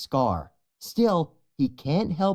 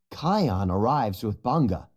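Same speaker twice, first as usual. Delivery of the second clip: usual speaking voice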